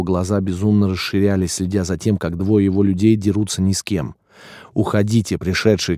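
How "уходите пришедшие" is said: ordinary delivery, nothing marked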